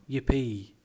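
loud "yippee" cheer; a storyteller's cheer; gleeful cry. A narrator's reading. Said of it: "the T's cried out".

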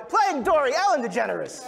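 loud, high, triumphant name reveal; announcer introduction. Acted. Host finally introduces the actress playing Dory, announcer style. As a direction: announce the star to the crowd.

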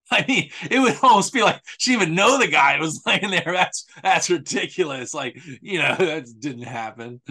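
laughing in disbelief; mocking with laughter. Real, not acted. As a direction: chuckle through the sarcasm. Laughing mockery.

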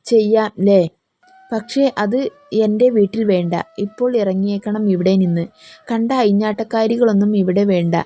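ordinary delivery, nothing marked

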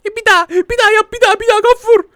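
put-on high squeaky excited baby. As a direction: beg like a thrilled baby. Baby begging again.

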